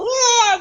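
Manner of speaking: shrill hammed-up baby wail, very high and loud; cartoon cry of someone fleeing; mock wail. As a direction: let out a mock crying wail. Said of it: "they just go".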